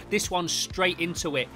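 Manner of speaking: usual speaking voice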